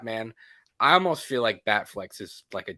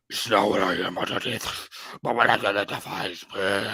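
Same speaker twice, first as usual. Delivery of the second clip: low, garbled superhero growl; 6 semitones lower than usual. Retold or imitated. Actor at screen test, mock deep Batman growl. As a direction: overdo a deep, slurred masked-hero rasp. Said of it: "you doing a screen test".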